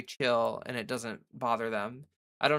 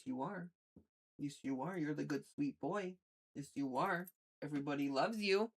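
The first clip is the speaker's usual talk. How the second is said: much softer doting pet talk; 2 semitones higher, 9 dB quieter than usual. Real, not acted. Baby talk to her cat.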